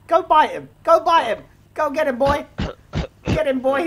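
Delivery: high, loud urging to a dog; attack command. Pretend. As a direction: sic the dog on him. Setting the dog on a man.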